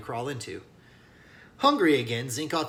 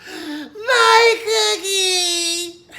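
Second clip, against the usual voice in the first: long, high, very loud wail; child screaming at his fallen cookie; anguished scream; 12 dB louder, much slower than usual. A narrator's reading. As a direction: scream it as the cookie hits the floor. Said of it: "and he screams".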